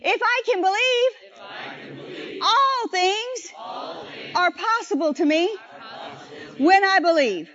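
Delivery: drawn-out, climactic shout; slow shouted refrain. Shown as a demonstration. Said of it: "say it with me".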